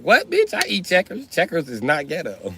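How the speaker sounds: high, offended squeal starting on bitch; mock-offended pitch jump; indignant comeback. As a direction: play the outraged Checkers defender.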